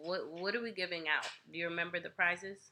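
normal talking voice

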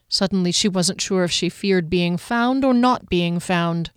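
plain reading voice

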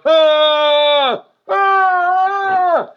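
high loud pained wails; winded cry. Retold or imitated. Daughter still on the ground, loud high pained cries. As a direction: cry out in pain again. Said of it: "after about a minute of".

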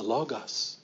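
"logos" as LAH-gahss, open ah in both syllables; Greek ah vowel. Shown as a demonstration. Preacher showing congregation the Greek letter, "logos" as LAH-gahss. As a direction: say "logos" with ah for each O. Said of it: "more of a ah".

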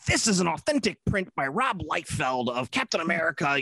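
salesy pitchman voice. Retold or imitated. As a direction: oversell it like a shopping channel host. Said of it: "So they'll be like".

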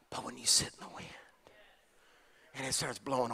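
soft unvoiced whisper; preacher hushing the congregation. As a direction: drop to a whisper to draw them in. Hushing the room mid-sermon.

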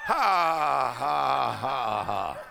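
big slow "ha, ha, ha" falling in pitch; stately, drawn-out mock laugh; imitated laugh of God. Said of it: "God just sits in heaven and he goes".

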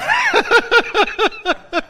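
high, loud cackle of real laughter; high burst of laughter. Real, not acted. High laugh after his line.

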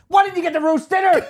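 high, loud mock exasperation; high loud outburst. Asking Charlotte about her meal, high and loud. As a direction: blurt it incredulously. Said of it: "I was like".

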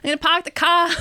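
imitated Boston ad, R's dropped; Boston accent. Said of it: "do a Boston accent".

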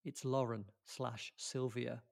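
ordinary delivery

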